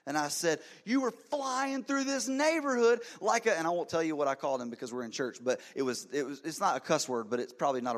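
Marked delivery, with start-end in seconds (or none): 0.9-3.6 high, loud, slow furious accusation; yelling. Retold or imitated. Pastor yelling at the driver for speeding, high slow furious yell. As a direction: drag it out, seething at the reckless driver. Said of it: "as I yelled that".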